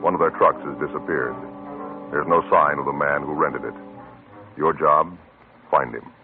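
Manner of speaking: usual speaking voice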